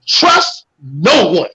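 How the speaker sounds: very slow, each word laid down, trust hit hard; slow solemn warning with trust stressed. Acted. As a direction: drag out trust no one like a grave warning. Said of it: "listen to me really very carefully".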